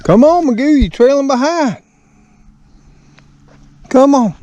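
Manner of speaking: high, loud call to a dog lagging behind; sing-song shout after a straggling dog. Real, not acted. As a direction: call out to hurry the dog along. Calling his dog on a walk.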